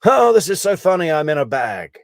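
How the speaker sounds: high, loud, amused mock glee; mock laughing. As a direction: imitate him laughing it off. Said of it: "showed him laughing and going".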